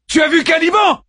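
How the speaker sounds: high loud yell; yelled question. A narrator's reading. Daeman yelling toward the lit part of the infirmary, loud. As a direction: shout it across the room. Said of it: "hurla-t-il".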